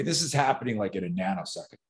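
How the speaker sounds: usual speaking voice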